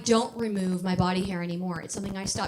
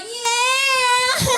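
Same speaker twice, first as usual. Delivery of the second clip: long, high, loud whoop; re-enacted triumphant yell; 13 semitones higher, 8 dB louder than usual. Comic revels as crowd turns on heckler, screamed cheer. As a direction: scream it out like a stage victory. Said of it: "I was on stage like".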